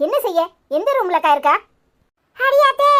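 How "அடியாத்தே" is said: high, loud startled exclamation; alarmed refusal. Acted. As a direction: recoil from the idea, voice shooting up. (பதறிப்போய் உரக்க மறுப்பது)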